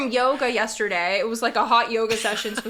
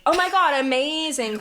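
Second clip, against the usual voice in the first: higher, mock-amazed gush; gushing praise; 4 semitones higher than usual. Showing how she should have praised his soup, gushing. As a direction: gush as if hugely impressed. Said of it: "I should have been like".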